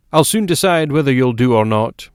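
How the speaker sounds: plain-spoken man telling his wife to hush; gruff newspaper man. A narrator's reading. Narrator voices McGovern.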